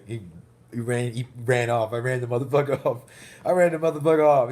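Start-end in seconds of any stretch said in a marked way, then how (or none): 1.9-4.5 repeats it louder, nearly yelling with glee; bragging about chasing the doctor off, loud and gleeful; triumphant shout. Real, not acted. To the camera, bragging he ran the doctor off, gleeful shout. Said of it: "repeats louder, laughing".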